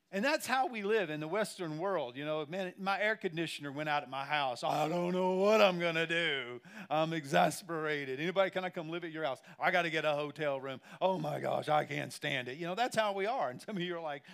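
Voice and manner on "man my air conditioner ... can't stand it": louder, overdone exasperation; mock dramatic complainer. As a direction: ham up the spoiled homeowner. Mocking complainer about AC.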